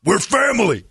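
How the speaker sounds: high, loud, slow mock demand; mock bossy shout. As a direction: bark it like a pushy boss.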